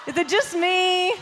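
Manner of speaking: high call-out to the crowd. Real, not acted. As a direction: shout it out to open the set. Comedian opening, shouting to crowd.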